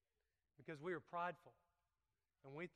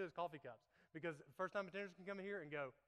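ordinary talk, nothing marked

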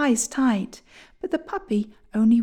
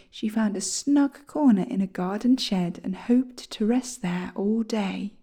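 ordinary delivery, nothing marked

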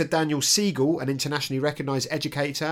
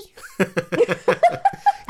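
high laugh in Boston voice; 5 dB louder than usual. Retold or imitated. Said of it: "You a lady?".

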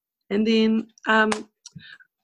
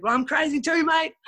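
mock-crazy retort; 7 semitones higher than usual. Retelling her retort to knife threat.

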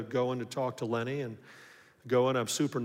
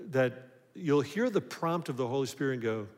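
ordinary talk

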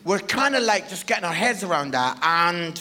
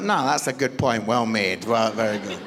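low, subdued agreement; low, understated approval; deadpan concession; 12 semitones lower than usual. Retold or imitated. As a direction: concede the point drily. Father concedes son's blunt point.

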